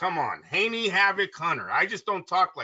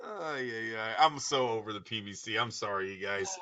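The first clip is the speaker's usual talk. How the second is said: low, soft, drained sigh of words; spent, subdued resignation; weary apology; 8 semitones lower, 8 dB quieter than usual. Real, not acted. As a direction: let it sink low and quiet, fed up. Weary apology after laughing fit.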